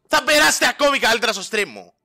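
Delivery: loud promo shout-out; hype plug. Acted. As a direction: sell the stream with big energy. Hyping own stream.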